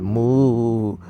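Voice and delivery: low, drawn-out mock moo; cow moo. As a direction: moo back like a dumb cow. Cow answers the stargazer.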